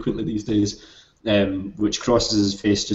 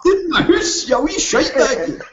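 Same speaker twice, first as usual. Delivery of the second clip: much higher, laughing Scottish insult; hammed-up Scottish accent; 5 dB louder than usual. [name] hurling a Scottish insult, hammed up and laughing. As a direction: ham up the Scottish fury, cracking up. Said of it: "ham up the Scottishness for you".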